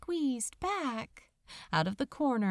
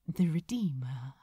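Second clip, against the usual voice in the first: whispered; 6 semitones lower than usual. Acted. Self-conscious angel names himself.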